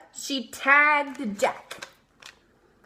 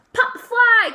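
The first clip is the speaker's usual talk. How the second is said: high, loud call; pitcher calling; 9 semitones higher, 6 dB louder than usual. A narrator's reading. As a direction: call the catch to the team. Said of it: "called the pitcher".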